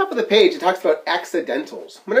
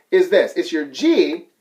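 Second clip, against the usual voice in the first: ordinary delivery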